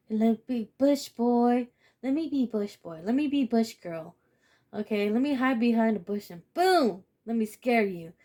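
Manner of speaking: lightly voiced bush-hiding prankster, hammed up; mock lurker. Acting out a lurker hiding to jump out, mocking.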